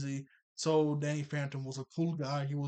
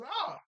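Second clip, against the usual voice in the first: yelp of pain. Retold or imitated. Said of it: "He goes".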